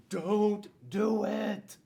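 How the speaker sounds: big, slow, loud shout of warning. Acted. Teacher booming the book's refrain at the class. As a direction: stretch it out and boom it.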